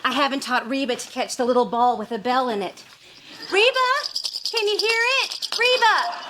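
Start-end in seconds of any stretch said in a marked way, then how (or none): 3.5-6.2 very high singsong calling; high-pitched coaxing. Acted. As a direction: call her like a pet, way up high. Coaxing Reba with a belled ball.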